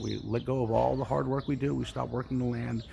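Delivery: usual speaking voice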